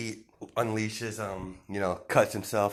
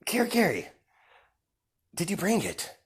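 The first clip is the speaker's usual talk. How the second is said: menacing villain Deku; 10 semitones higher than usual. Acted. Deku telling the villains they angered his boss, high and taunting. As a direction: lord it over them, savouring each threat.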